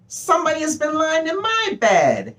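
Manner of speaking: very high, shrill tiny bear; little wee bear voice. A narrator's reading. Little wee bear finds Goldilocks in his bed, little wee voice. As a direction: squeal it sharply, slowing at the end. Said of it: "said the little wee bear in his little wee voice".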